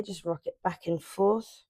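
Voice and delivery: usual speaking voice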